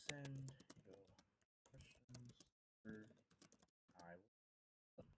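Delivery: slow, quiet, broken-up dictation; typing aloud. Real, not acted. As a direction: murmur it word by word while typing. Slowly voicing a message while typing.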